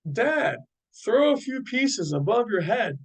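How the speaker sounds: put-on high-pitched, eager boy; excited suggestion. A narrator's reading. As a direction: call out an eager idea to dad. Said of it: "Jerry suggested excitedly".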